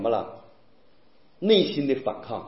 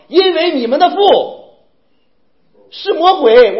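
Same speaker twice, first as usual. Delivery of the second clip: stern rebuke; 9 semitones higher, 9 dB louder than usual. Retold or imitated. Jesus rebukes the Pharisees.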